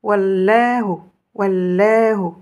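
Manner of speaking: Wallahu lié lentement, le waw collé au l; avec la wasla. Shown as a demonstration. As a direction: liez le waw à Allahu sans coupure. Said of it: "vous aurez la wasla". (Wallahu lié par la wasla, lentement)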